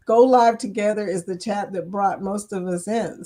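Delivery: ordinary talk, nothing marked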